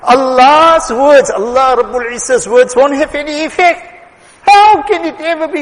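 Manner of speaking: rising preacher's outcry; forceful preaching. Real, not acted. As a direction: proclaim it to the congregation. Preacher stresses Allah's words.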